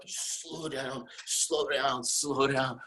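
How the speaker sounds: slow, winding-down repetition; drawn-out slowing. Retold or imitated. Luger describing braking after the finish, drawn out. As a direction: stretch each repeat as the sled slows.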